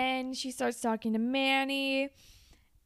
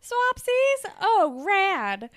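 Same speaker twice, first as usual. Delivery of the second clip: high, loud gushing echo of swapsies; over-the-top thrilled repeat; mock excitement; 11 semitones higher, 8 dB louder, much slower than usual. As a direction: squeal it back like it's the best news ever. Mocking repeat of silly word.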